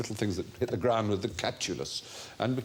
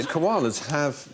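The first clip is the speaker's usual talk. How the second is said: normal talking voice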